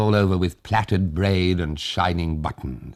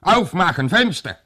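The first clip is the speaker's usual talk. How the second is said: barked German order, much higher and louder; German shout; 12 semitones higher, 4 dB louder than usual. Acted. Soldier outside ordering the family to open up, German shout. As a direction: shout it through the window pane.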